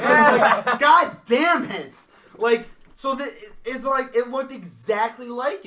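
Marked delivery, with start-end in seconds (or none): 0.8-2.1 slow curse broken by laughter; laughing curse. Real, not acted. Realizing she bought cabbage, a slow laughing curse. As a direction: curse while cracking up.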